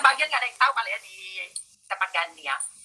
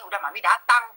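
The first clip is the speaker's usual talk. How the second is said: usual speaking voice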